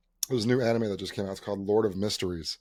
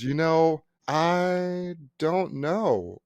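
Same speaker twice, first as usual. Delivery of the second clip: higher, very drawn-out mock admission; clueless receptionist; 5 semitones higher, much slower than usual. Receptionist admitting she doesn't know the holiday, drawn-out imitation. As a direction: stretch "don't know" out slowly in her voice. Voicing receptionist not knowing.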